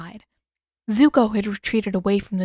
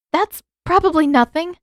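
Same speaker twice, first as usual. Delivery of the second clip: quick, higher, louder denial; blurted; 8 semitones higher, 7 dB louder than usual. A narrator's reading. Katara covering for the noise behind the door, blurting quickly. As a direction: blurt it out too fast. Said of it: "Katara blurted".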